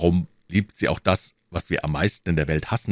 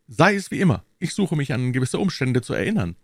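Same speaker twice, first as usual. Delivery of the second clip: higher-pitched, thoughtful Aramis; a lightly raised character voice for Aramis; lightly voiced musketeer; 7 semitones higher than usual. Aramis trying to recall circumstances, lightly voiced in a higher pitch.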